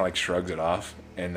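usual speaking voice

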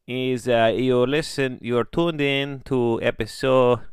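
put-on slow, loud broken-English announcer with a vague foreign accent. Host opening the podcast in broken English, hammed-up foreign accent.

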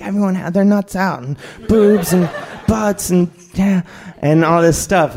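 high-pitched giddy report; mock excited gushing. Dave telling total strangers about the nudity, excited. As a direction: gush it out in a high voice.

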